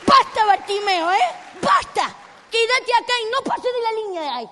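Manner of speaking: loud, firm telling-off; stern ordering. Acted. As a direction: lay down the limit to a troublemaker. Usher orders Bartimeo to stay put. (orden severa en voz alta)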